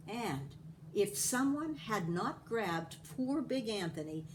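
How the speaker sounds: ordinary delivery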